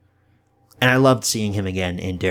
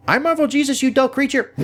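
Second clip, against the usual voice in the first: much higher, cartoonish squeaky mock voice; mock Deadpool line. Reviewer quoting the taunt at a foe, squeaky mock voice. Mocking quoted movie line.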